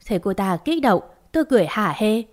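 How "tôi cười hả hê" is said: plain reading voice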